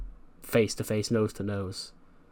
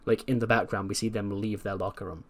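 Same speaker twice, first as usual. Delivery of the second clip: usual speaking voice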